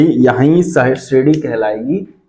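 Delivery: ordinary talk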